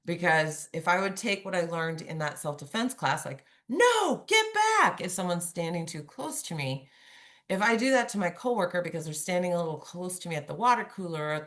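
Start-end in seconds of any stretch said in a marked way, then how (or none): 3.7-5.0 loud, high-pitched bark of command; drill sergeant shout. Shown as a demonstration. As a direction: shout it like a self-defense drill. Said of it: "sound like a drill sergeant".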